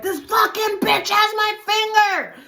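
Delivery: slow, high, loud re-enacted holler; outraged yell. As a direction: yell for help while being bitten. Re-enacts yelling while being bitten.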